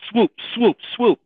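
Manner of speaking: high, loud whooshing swoops; swoop sound effect. Pretend. Podcast host mimics Ridley Scott swooping in, loud whoosh. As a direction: make the swooping noise like a ship diving in.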